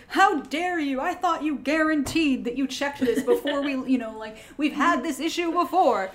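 loud, high, outraged merchant; indignant rich merchant. Acted. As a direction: scold like a wealthy merchant. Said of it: "an indignant, rich merchant voice".

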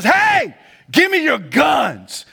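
loud, high shouted order, slowing on "give me your guns"; tough guy demand. Retold or imitated. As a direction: command the robbers to hand them over. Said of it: "walks up to him and says".

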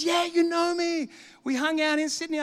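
high, stretched-out reminder; expressive insistence. Retold or imitated. Old acquaintance insisting to the preacher, excited. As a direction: imitate an excitable man insisting on being remembered. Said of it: "he's so expressive".

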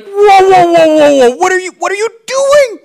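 high panicked mock shout of alarm; scared. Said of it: "so he probably didn't sound like that scared".

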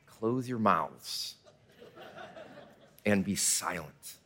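slow, low and soft, calling for silence; hushed command. Retold or imitated. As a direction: settle the kids down quietly. Retelling teacher's hush command.

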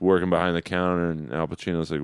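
ordinary talk, nothing marked

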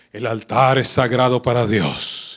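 slow, loud, reverent pronouncement; solemn church saying. Retold or imitated. Preacher recalling church teaching, slow loud solemn. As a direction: intone it slowly like a sacred rule. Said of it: "donde te decían".

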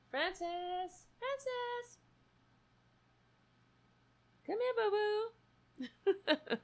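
very high, soft pleading call; cat-calling voice. Real, not acted. Owner begging her cat to come, very high. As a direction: plead with the cat, almost crying.

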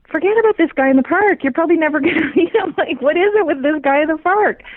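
high, exasperated rant about the guy; exasperated outburst. Retold or imitated. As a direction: throw up your hands at this obsession. Retelling frustration about the guy.

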